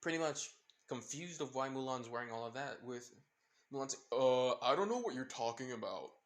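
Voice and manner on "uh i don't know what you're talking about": a faked manly voice, a touch louder; masculine deep voice. Mulan hiding from Izuku, mock manly voice. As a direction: Mulan bluffing in a put-on man's voice. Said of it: "like, a masculine deep voice".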